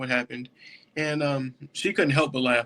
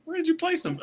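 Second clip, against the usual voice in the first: 1 voicing the sergeant; 12 semitones higher than usual. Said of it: "he says".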